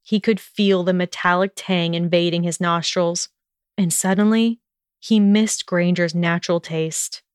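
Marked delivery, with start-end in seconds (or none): none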